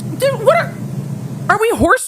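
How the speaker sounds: incredulous outburst. Real, not acted. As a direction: squeak out shocked disbelief. Exasperated at bargaining.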